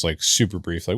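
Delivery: ordinary talk, nothing marked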